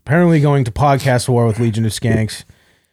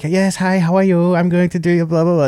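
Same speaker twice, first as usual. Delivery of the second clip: put-on higher-pitched caricatured Japanese accent; mock Japanese accent; 6 semitones higher than usual. Patient mimicking the ultrasound lady's greeting, mock Japanese accent. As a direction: imitate a Japanese woman's accent. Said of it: "doing the, quote, accent".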